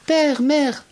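high, drawn-out call to parents; crying out. A narrator's reading. Nils leaps out to his parents, crying out. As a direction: call out high to stop them. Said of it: "à son tour il crie".

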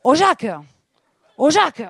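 loud, high, desperate calling out; Rose from Titanic, southern accent. Pretend. Rose calling to Jacques in the water, desperate loud cry. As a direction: call out to him in the water. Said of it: "à la place de Rose dans Titanic".

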